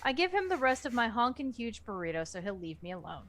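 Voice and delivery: ordinary delivery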